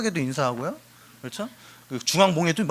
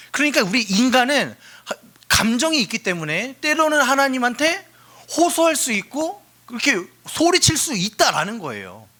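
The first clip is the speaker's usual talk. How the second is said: normal talking voice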